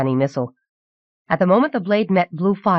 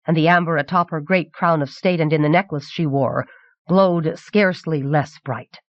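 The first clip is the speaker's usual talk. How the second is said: ordinary delivery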